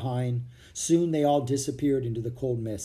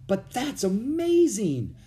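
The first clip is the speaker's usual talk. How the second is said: high, drawn-out astonishment; amazed; 9 semitones higher, 4 dB louder, slower than usual. A narrator's reading. Captain hearing the children share his hometown, amazed. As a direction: exclaim it with slow wonder.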